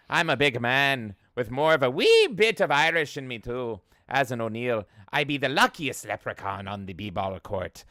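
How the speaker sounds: imitating an Irish [name]; Irish Shaq imitation. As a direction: ham up an Irish accent. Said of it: "Irish O'Neal".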